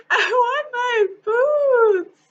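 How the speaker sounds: slow, high, loud wailing cry; little girl crying for her boots. A narrator's reading. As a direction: sob it out like a heartbroken child. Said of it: "she cried".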